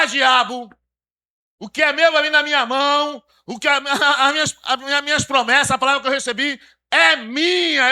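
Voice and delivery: loud defiant declaration; defiant shout at devil. Pretend. Believer shouting down the devil, loud and defiant. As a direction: rebuke the devil, claiming what is yours. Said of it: "se levantar".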